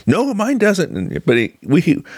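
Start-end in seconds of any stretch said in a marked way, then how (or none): 0.1-1.0 high, lightly mimicked teenage retort; mock contrary teen. Fourteen-year-old disputes his mentor about his Bible, high and contrary. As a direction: retort like an argumentative kid, pitched up. Retold argumentative teenage boy.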